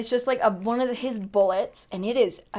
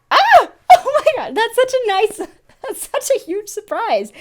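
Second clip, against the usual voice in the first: high, loud squeal of joy; bursting with happy surprise; real excited squeal; 11 semitones higher, 6 dB louder than usual. Real, not acted. Freaking out at surprise gift.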